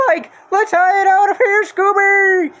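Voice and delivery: high, loud panicked cry; Shaggy fleeing the creeper. Acted. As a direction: shout it while bolting.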